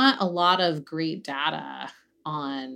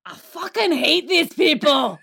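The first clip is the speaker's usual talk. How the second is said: put-on Scottish accent; 10 semitones higher than usual. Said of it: "he spent the entire time going".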